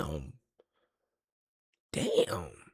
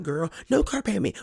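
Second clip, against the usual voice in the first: ordinary talk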